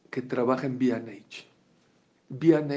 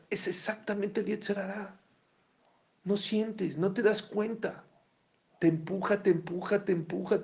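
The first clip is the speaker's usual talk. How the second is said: sly insinuation; 6 semitones higher than usual. Acted. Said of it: "él te habla dulce".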